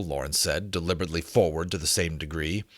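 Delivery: ordinary delivery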